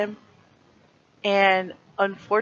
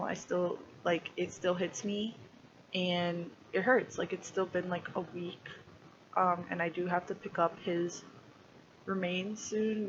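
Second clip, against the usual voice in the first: quiet, fading, close to tears; emotional; 13 dB quieter than usual. Real, not acted. Talking about losing her kitten Mitsu, quiet and choked. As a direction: let the grief pull the voice down to almost nothing. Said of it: "I still get very emotional".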